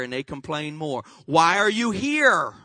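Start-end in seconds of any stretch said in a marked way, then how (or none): 1.3-2.5 louder questioning. Acted. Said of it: "And God is saying".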